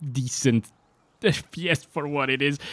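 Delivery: chuckling through the words; can't keep a straight face; laughing. Real, not acted. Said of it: "I can't keep a straight face".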